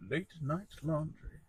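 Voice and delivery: soft, deliberate title drop; understated late-night murmur; hushed title read. Pretend. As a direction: say it quietly and smoothly, for effect. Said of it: "That's really good".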